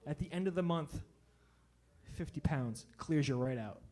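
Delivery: mock smooth cleanse pitch, low and soft; buddy's pitch. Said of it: "He's like".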